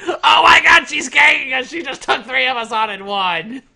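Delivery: loud, high mock yell of a panicked gamer; aufgedrehter Gamer-Schrei. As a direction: yell it like a gamer mid-battle. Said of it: "there's four men yelling".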